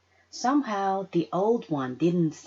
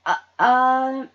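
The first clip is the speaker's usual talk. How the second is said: stammering; 6 semitones higher, 9 dB louder than usual. Acted. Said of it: "I stammered".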